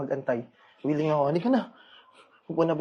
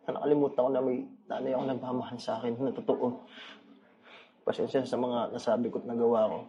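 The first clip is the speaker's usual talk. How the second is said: tearful; 5 semitones lower, 8 dB quieter than usual. Acted. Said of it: "Bakit parang umiiyak ka?".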